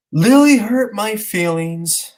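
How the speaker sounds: put-on high, slow child's complaint; mock wounded child voice; hurt little kid. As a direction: imitate a small boy tattling, drawn out. Young son brings a complaint.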